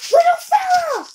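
high, loud delighted cry; excited. Real, not acted. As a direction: greet it with glee. Excited at seeing pet.

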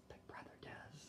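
soft stage whisper aside; hushed aside. Real, not acted.

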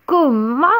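how dare you; outraged What. A narrator's reading. Witch outraged question.

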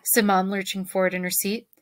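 ordinary delivery, nothing marked

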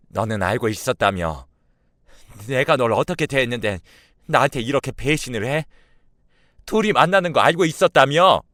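accusation building to a high, loud scream; betrayed friend yelling accusations; furious screaming. A narrator's reading. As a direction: escalate from angry challenge to full-out shriek. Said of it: "악을 지르는 겁니다".

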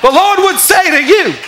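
loud, high, drawn-out proclamation; hammed-up prophetic shout; prophesying. Shown as a demonstration. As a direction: belt it out slowly like a prophet. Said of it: "just close your eyes and prophesy".

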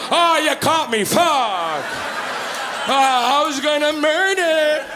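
hammed-up foiled murderer, high-pitched and drawn out; caught murderer. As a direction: play a killer comically owning up. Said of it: "are you yelling hello to the murderer?".

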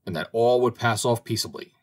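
usual speaking voice

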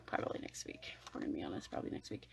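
hushed whispered aside; confiding whisper. Real, not acted. Admitting the video will be late, whispered aside. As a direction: let viewers in on a secret. Confessing delay.

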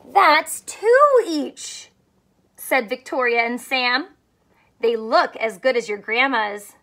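lively picture book reading; story read to children. Acted. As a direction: read it like storytime for little ones. Reads picture book aloud.

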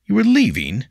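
high, loud startled question; the words jump out of him; a pupil stunned to find his teacher packing; shocked disbelief. A narrator's reading. William shocked teacher leaves.

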